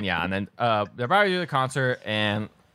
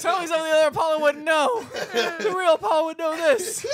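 high, fast, hammed-up panic; mock standoff demand. Two Paulos in a gun standoff, mock desperate demand. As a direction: rattle it out high, as if at gunpoint.

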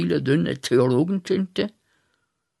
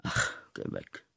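geflüstert; 13 dB quieter than usual. Acted. Weist Gott ab.

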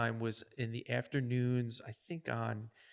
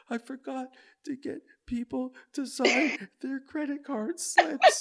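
mock panic; 11 semitones higher than usual. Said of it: "And she goes".

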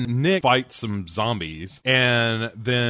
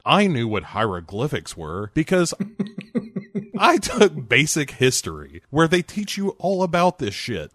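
ordinary delivery

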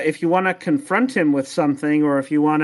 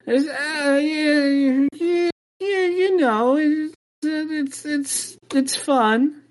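high, strained, unconvincing yeah; a small creature forcing out praise; squeaky reluctant elf; 11 semitones higher, much slower than usual. Acted. Elves forced to say they're happy.

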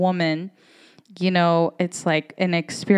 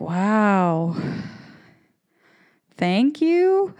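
sarcastic thanks; 3 semitones higher, much slower than usual. Retold or imitated. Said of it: "And it was just like".

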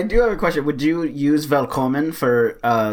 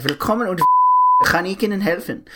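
German greeting; 5 semitones higher than usual. Retold or imitated. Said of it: "little bit of German".

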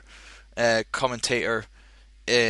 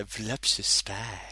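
low, soft, sultry drawn-out read; voluptuous seductive; 5 semitones lower, 8 dB quieter than usual. Shown as a demonstration. Demonstrating how the name should sound, low and sultry. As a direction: purr it seductively. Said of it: "Say that very voluptuous".

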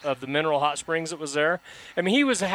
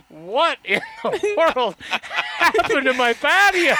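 drawn-out, stunned disbelief, half laughing; slow dumbfounded neighbor; 11 semitones higher, 5 dB louder, slower than usual. Retold or imitated. Neighbor noticing his wrecked patio, slow stunned disbelief. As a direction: stretch each word like you can't believe your eyes.

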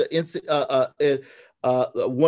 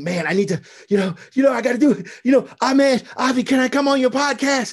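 eager inner voice; 9 semitones higher, 4 dB louder, faster than usual. Retold or imitated. Excited inner voice pitching himself.

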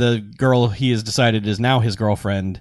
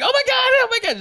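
very high repeated cry; mock panic. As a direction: spiral up into hammed-up panic. Retold girl panicking at dinosaur.

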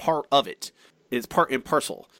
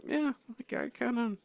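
a high half-whispered agreement; breathy, higher, softer reply; 10 semitones higher, 10 dB quieter than usual. Retold or imitated. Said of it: "this I'm like".

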